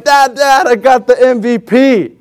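high, proud, slowed announcement; acted boyish excitement; excited kid. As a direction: break great news to dad. Replays telling dad the news.

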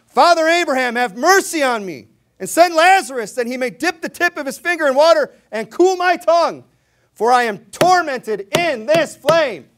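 high, loud desperate plea from the flames; anguished shout to Father Abraham; crying out in torment. Acted. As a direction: beg at full voice like a man burning. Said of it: "He's crying out".

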